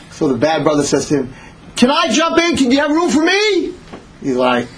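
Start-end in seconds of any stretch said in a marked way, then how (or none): 1.8-3.7 high-pitched begging holler; pleading call. Acted. Stranded brother begs across water.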